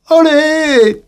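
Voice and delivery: high, loud joyous olé; hammed-up corrida shout; mock bullring cheer. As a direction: cheer like a whole arena. Bullfight crowd cheer, mocked.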